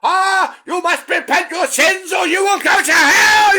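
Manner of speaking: full-throated, high hellfire shout; manic preacher. Acted. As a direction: thunder damnation at the congregation, hammed-up. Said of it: "these preachers are fucking manic".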